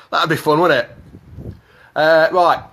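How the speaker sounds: ordinary talk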